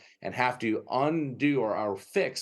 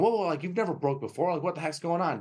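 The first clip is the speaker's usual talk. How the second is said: startled dog owner; 7 semitones higher than usual. Retold or imitated. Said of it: "And the owner's going".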